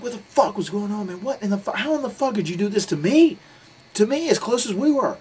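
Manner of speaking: high, loud wail of betrayal; retold anguished outburst. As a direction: re-enact a hurt, furious confrontation at high pitch. Imitated sobbing confrontation, measures very high.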